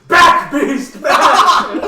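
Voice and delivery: loud, high, overdone monster-repelling cries; hammed-up shout. Xavier holding off a fake infested Adam, hammed-up shout. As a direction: bellow it like a terrible actor. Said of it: "He's playing this up".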